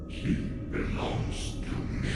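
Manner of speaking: slow, soft, cracked ghostly whisper; cruel cracked whisper. Acted. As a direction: hiss it slowly like something unseen. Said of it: "a cruel, cracked whisper".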